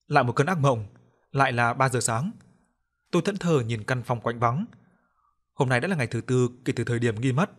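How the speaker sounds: ordinary delivery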